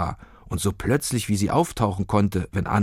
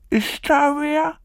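put-on very high, slow, feeble old voice; barely able to call out; trapped old woman answering weakly, high and slow; slower than usual. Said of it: "kam es matt von innen".

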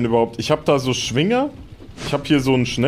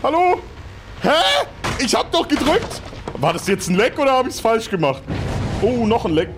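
aufgeregt verwirrt; 9 semitones higher than usual. Real, not acted. Live-Gaming, Verwirrung im Spiel.

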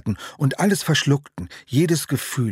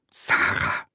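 hissed contemptuous name, breathy and low; spat out with contempt. Acted. Boy spitting out the rival girl's name, disgusted hiss. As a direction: spit the name out like something foul. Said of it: "spuckte verächtlich den Namen aus".

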